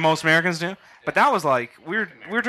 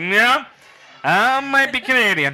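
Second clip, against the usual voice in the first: put-on drunk voice; 7 semitones higher, 4 dB louder, slower than usual. Drunk self answering cabbie.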